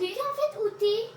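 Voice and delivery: high loud searching call; anxious calling. Real, not acted. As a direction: call out for your friend.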